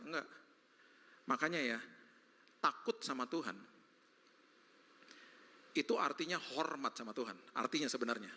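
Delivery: ordinary talk, nothing marked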